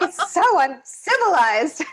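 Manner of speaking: high, slow, laughing mock horror; mock appalled. Guest laughs at her own bedding habits, mock scandalised. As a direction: drag it out like a prim, scandalised wife. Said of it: "I'm just like".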